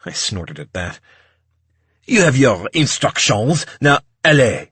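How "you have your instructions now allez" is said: loud, commanding French maitre d'; thick French accent. A narrator's reading. Maitre d' sending waiters off, loud French accent. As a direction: dismiss the staff in an exaggerated French accent. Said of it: "in a thick French accent".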